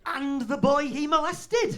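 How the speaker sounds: loud, high, slow mock yell; hammed-up triumphant shout of a mocking friend; shouted punchline. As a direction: bellow the punchline, stretching each word.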